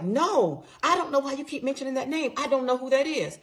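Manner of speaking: high-pitched mock protest; insistent retold denial, pitched well up; indignant denial. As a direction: imitate a husband loudly denying everything. Retold quarrel, husband denying it.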